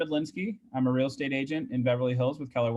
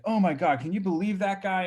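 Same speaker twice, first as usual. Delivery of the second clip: retold indignation; 6 semitones higher than usual. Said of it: "I was so angry".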